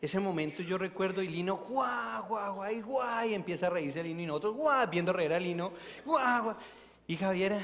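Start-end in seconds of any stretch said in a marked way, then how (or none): 1.6-3.3 playful woof-like laughing yelps; mock barking laugh. Recalling Lino's contagious laugh, mock barking. 4.6-6.6 high, loud woof-like laughter; mock barking laugh. The group laughing with Lino, high mock barking.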